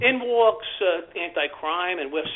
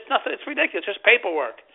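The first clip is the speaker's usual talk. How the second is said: dismissive; 6 semitones higher than usual. Retold or imitated. Dismissing the cash bag.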